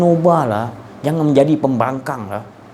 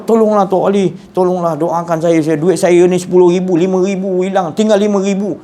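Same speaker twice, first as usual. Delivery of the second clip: mock weeping plea, higher and louder, rushed; tearful pleading; 6 semitones higher, 3 dB louder than usual. Man begs Tok Ali to pray for his money, mock sobbing plea. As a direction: beg through pretend tears, pushing fast. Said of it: "Menangis-nangis dia, merayu".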